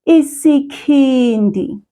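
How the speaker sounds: isikhindi said slowly, each syllable clear; patient teacher model of isikhindi; slow model word. Shown as a demonstration. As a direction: model isikhindi for the child to repeat. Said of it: "Repeat after me".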